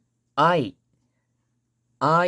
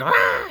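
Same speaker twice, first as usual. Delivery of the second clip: loud battle grunt "Ah"; sword-swing cry; 6 semitones lower, 5 dB louder than usual. Retold or imitated. Teacher imitating an anime fighter, loud effort cry. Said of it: "swings their sword and they're going".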